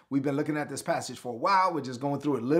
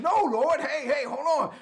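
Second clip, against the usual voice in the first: alarmed Martha; 11 semitones higher than usual. Retold or imitated. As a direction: cry out to halt Jesus, pitched way up. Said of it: "Martha said".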